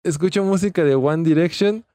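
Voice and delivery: loud hammed-up reading of a post; loud mock reading. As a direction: read the post out loud, mocking. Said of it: "Y dice".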